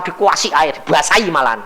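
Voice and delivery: usual speaking voice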